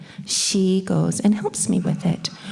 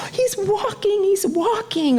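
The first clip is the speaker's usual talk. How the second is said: much higher, thrilled gasp of a parent; excited parent; 11 semitones higher than usual. Retold or imitated. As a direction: squeal with delight at baby's first steps. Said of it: "their parents get really excited".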